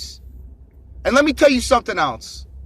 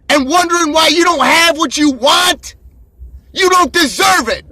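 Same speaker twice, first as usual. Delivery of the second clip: loud, higher, pounding motivational shout; shouting down the camera at lazy viewers; fired-up shout; 5 semitones higher, 4 dB louder than usual. Real, not acted. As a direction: yell it at them like a coach who's had enough.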